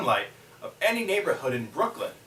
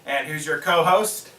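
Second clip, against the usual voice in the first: loud, raised late-show host intro; show announcer; 4 semitones higher, 6 dB louder, slower than usual. Acted. Host welcoming the audience and introducing the co-host, announcer voice. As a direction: announce it big, stretching out the co-host's name.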